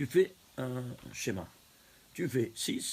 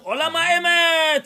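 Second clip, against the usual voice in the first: loud, high, slow proclamation; proclaimed punchline; 13 semitones higher, 12 dB louder, slower than usual. Acted. Rabbi delivering the punchline to the doctor, slow and loud. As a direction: ring out the answer like a verdict.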